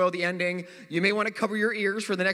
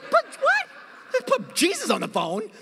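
a much higher, baffled echo of her demand; incredulous repeat; 11 semitones higher, slower than usual. Retold or imitated. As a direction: repeat it like you can't believe it. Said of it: "You're like".

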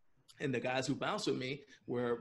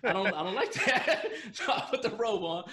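high laughing delivery; giggling at the memory; 8 semitones higher, 8 dB louder than usual. Real, not acted. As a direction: laugh through the words. Laughing retelling robe worry.

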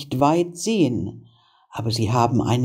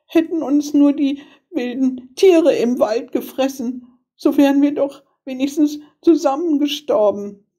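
put-on high-pitched girlish lament; high, plaintive little-girl voice; wailing little sister; 10 semitones higher than usual. A narrator's reading. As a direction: lament as a despairing child. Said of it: "wie jammerte das arme Schwesterchen".

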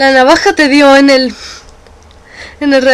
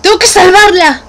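rising to a loud determined cry; shouting her resolve to save Frost; 7 semitones higher, 5 dB louder than usual. Acted. Declaring she must save Frost, loud and determined.

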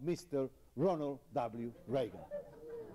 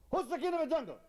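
squeaky high bully of a lion, demanding; bossy lion; 3 dB louder, faster than usual. Acted. Nasty lion demanding an answer from the animals, in a high squeaky bark. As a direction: play the lion as a pint-sized tyrant.

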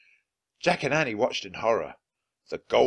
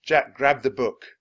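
ordinary delivery, nothing marked

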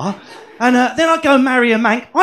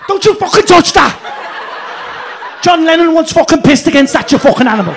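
tertahan lalu meledak, mengusir dengan kasar; angry Scouser; 6 semitones higher, 5 dB louder than usual. Retold or imitated. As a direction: bentak seperti warga Liverpool yang tersinggung. Scouser guarding Lennon brick.

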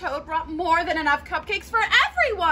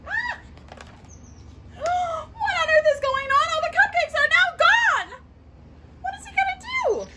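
shocked alarm; 12 semitones higher than usual. Acted. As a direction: cry out that everything is gone. All cupcakes gone, alarm.

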